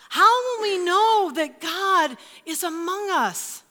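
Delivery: high, drawn-out pleading questions; people left asking. Acted. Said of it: "the people of God were left asking".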